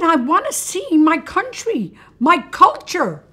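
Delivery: high, loud proclamation as an ancient philosopher; hammed-up grand demand; impassioned Aristotle. Aristotle tells the steward what he came for, impassioned.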